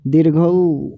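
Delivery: दीर्घऊ with the ऊ held long and slow; long vowel demo. Shown as a demonstration. Teacher showing students the long vowel, drawing out दीर्घऊ. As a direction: stretch out the ऊ in दीर्घऊ. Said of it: "ऐसे".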